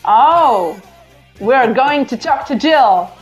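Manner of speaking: usual speaking voice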